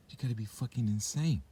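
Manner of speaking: ordinary talk, nothing marked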